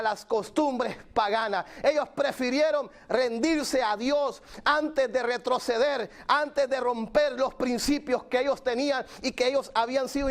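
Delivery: high-pitched, driving pulpit delivery; fervent preaching. Real, not acted. Preacher challenges believers with Daniel's answer, fervent preaching. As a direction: preach with mounting insistence, stretching key phrases.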